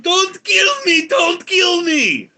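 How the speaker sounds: very high, drawn-out shout of terror; hammed-up shriek begging for your life; panicked pleading. Advisor pleading with Putin for life.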